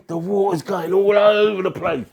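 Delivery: high, loud, animated storytelling; excited fight retelling. Retold or imitated. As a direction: relive the chaos at the top of the voice.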